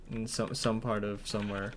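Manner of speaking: ordinary talk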